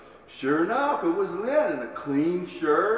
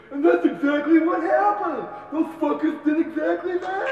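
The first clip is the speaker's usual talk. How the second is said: higher and louder, laughing eager agreement; excited confirmation; 12 semitones higher, 5 dB louder than usual. Retold or imitated. Lynn at a party eagerly confirming the story. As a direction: back up the story with glee.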